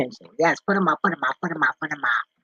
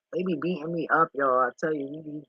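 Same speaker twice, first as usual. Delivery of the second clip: normal talking voice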